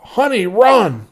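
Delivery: loud, high, slow yell; a father yelling at his girl to run. A narrator's reading. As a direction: shout the warning to her. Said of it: "The man yelled out".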